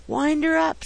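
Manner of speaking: high, eager call; keenly interested. A narrator's reading. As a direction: urge it on with boyish eagerness. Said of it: "with great interest".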